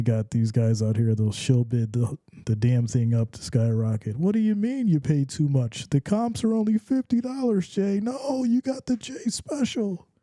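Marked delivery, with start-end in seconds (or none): 4.2-8.0 lightly voiced buyer, pitched higher; a touch of a whiny customer protesting; hint of a complaining buyer. Mocking a buyer who paid too much, higher complaint. 8.0-10.1 mock smug seller. Imitating a shill seller answering the buyer, sly and high.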